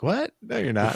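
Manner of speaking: high-pitched incredulous "what?", then dismissive; imitated amused disbelief. Stepmom laughing off his confession, high mock disbelief. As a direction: squeak the question up high, mimicking her surprise. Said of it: "she laughed and she's like".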